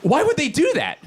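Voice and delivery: high-pitched, bewildered outrage; frazzled. Acted. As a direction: splutter it, worked up and baffled. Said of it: "fucking frazzled I am right now".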